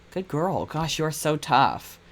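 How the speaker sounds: ordinary talk, nothing marked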